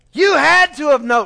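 loud, high-pitched mock outrage; hammed-up accusing cry; incredulous wife. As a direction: burst out in disbelief as the wife. Wife's disbelief at oblivious husband.

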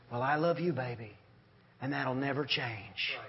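calm loving mom. Retold or imitated. Voicing mom answering teen.

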